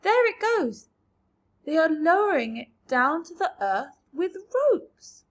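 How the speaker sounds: loud, high excited call; excited cry. A narrator's reading. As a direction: point and cry out. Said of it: "cried James".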